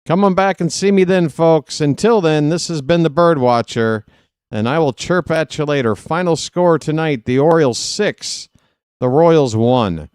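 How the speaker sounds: mild announcer outro; broadcaster sign-off. Acted. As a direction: wrap up like a radio host. Sign-off as the Bird Watcher.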